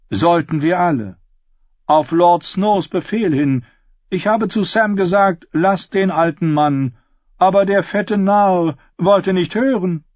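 high, forceful grumbling singer; aggrieved singer Darion. Acted. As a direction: complain loudly about the one who ignored you. Voices Darion complaining. (hohe, verärgerte Stimme des Sängers)

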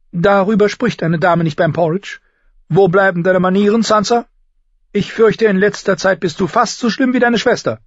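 high, indignant septa voice; scolding governess. Acted. Septa rebukes girl for bad manners at porridge, scolding. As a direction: scold loudly in a high, proper voice.

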